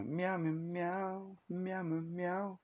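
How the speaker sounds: soft, high little cat meows. Pretend. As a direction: meow like a small cat. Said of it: "one arm says".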